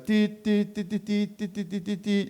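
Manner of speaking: soft spoken beeps, fading; imitated Morse beeps. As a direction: tap out Morse code with your voice. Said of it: "옛날에 모스부 있잖아요".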